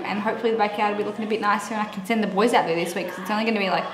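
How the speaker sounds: rushed, quick chatter; fast, breathless run of words; talking really fast. Real, not acted. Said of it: "Now I'm talking really fast".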